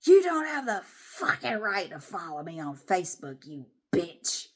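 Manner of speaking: mock creature growl, spat out word by word; growling imitation. Woman mimicking neighbour's Facebook accusation, growling and hissing. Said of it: "grumbling and growling and hissing".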